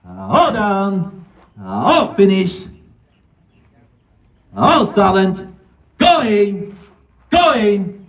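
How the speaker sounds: high, slow final calls; auctioneer calling. Real, not acted. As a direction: draw out the closing calls. Auctioneer closing the sale.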